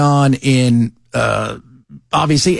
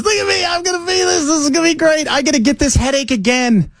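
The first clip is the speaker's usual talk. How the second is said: much higher, giddy mocking of the eager speaker; hammed-up giddy impression, much higher; mock excited; 12 semitones higher than usual. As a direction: lampoon him as a kid showing off, pitched way up. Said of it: "he's all excited".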